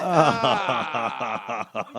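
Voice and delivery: ordinary talk, nothing marked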